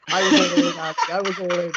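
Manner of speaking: breaking into a laugh mid-line. Real, not acted. Guest laughing to the host about the game, laughing.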